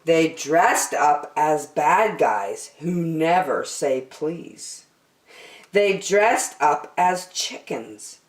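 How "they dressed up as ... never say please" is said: plain reading voice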